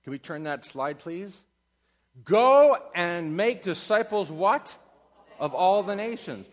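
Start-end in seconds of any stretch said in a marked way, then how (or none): 2.2-6.5 loud, high and slow; proclaimed verse; declaiming scripture. Acted. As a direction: call it out as a command.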